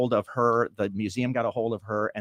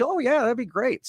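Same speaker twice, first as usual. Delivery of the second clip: cheerful woman; 12 semitones higher than usual. Retold or imitated.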